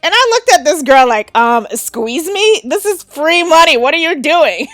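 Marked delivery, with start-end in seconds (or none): 1.4-4.7 higher, louder, mock-stunned "are you serious"; incredulous disbelief. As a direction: act out gaping at someone passing up free cash. Looking at girl refusing money.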